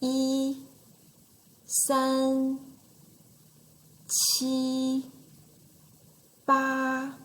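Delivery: "Yi, san, qi, ba" each held high and level; first tone. Shown as a demonstration. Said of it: "first tone one more time".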